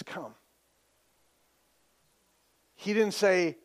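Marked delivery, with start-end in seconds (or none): 0.0-0.3 very soft, low single word; quiet invitation. Acted. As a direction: barely raise the voice, gentle and low. Preacher repeats Jesus' answer.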